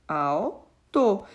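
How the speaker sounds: "alto" broken in two: al, to; split into syllables. Shown as a demonstration. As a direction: say "alto" syllable by syllable, pausing after "al". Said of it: "quando eu fizer a separação de sílabas".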